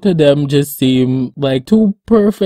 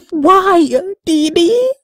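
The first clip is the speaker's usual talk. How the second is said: high, dramatic wail; hammed-up awe at her voice; mock despair; slower than usual. Fan overwhelmed by the singer, mock wailing high.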